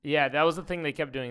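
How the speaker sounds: normal talking voice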